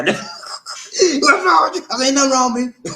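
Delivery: high, loud mock-crying squeal; retold crying. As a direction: wail it, half laughing, as if retelling tears. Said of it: "I started crying".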